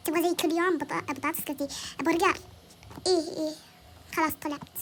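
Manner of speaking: usual speaking voice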